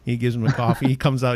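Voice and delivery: usual speaking voice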